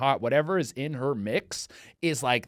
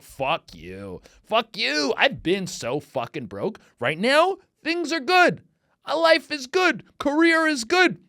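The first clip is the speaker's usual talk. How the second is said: angry retort; 9 semitones higher, 5 dB louder, slower than usual. Retold or imitated.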